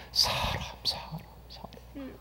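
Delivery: a breathy hush on the repeated word; hushed whisper. Real, not acted. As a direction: whisper each word, almost no voice. Stressing people as the hardest thing.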